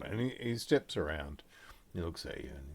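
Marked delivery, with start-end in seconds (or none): none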